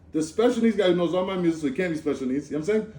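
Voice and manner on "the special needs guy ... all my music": ordinary talk, nothing marked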